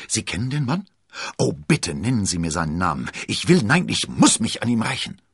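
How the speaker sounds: urgent, high and loud pleading; impatient. A narrator's reading. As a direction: beg impatiently, building in force. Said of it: "zappelte vor Ungeduld". (ungeduldig drängend)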